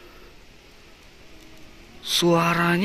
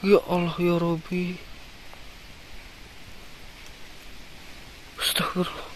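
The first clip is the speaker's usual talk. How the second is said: hushed, breathless prayer under the breath; a real whispered prayer of shock; 10 dB quieter than usual. Real, not acted. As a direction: whisper it in horror. Horrified at the creature.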